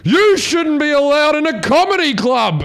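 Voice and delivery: loud, high-pitched indignant yell; furious retort at full volume; angry heckling woman. Retold or imitated. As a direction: yell it back, outraged and high. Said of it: "one of the women went".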